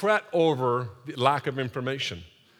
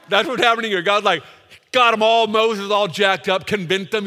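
louder, higher excited preaching; 5 semitones higher, 8 dB louder than usual. Real, not acted. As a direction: drive it home to the crowd.

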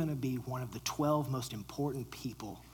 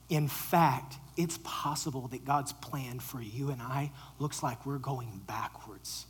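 ordinary delivery

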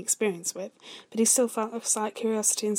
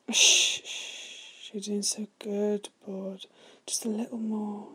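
murmuring; slower than usual. Acted. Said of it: "Vhagar murmured".

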